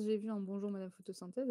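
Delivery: ordinary talk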